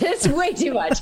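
laughing it out. Real, not acted. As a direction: laugh through the words. Repeats phrase, then laughs.

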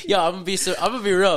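ordinary talk

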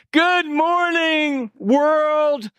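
high, drawn-out, smiling wake-up cry; mock cheerful greeting. Preacher acts out jumping out of bed happily, mock cheerful. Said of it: "with a big smile on your face to say".

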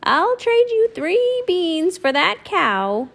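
put-on high, slow old woman; old woman tempting Mickey. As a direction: croon the offer like an old crone. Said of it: "said the old woman".

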